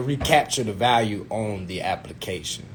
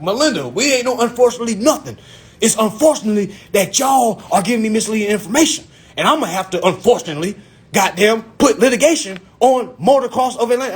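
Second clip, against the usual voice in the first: high, indignant threat; hot-headed; 6 semitones higher, 3 dB louder than usual. Retold or imitated. Applicant threatening advisor with litigation, hot-headed. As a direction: retell a blustering legal ultimatum. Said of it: "in my hot head stage".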